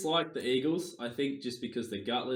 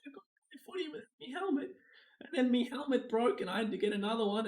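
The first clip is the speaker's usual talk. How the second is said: high squeaky mocking voice of the player; mock whiny player; 14 semitones higher than usual. Mocking the Bulldogs player in a squeaky voice. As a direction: mimic him in a silly high voice. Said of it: "And said".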